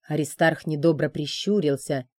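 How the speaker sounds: usual speaking voice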